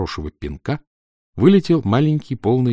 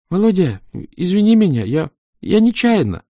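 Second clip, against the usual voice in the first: pleading apology; 11 semitones higher, 5 dB louder than usual. A narrator's reading. Makeup boy apologising through the door.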